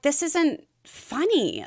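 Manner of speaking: slow, stern put-down; wife scolding giggling husband, slow and stern. Retold or imitated. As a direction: drag it out, unamused. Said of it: "And I'm like".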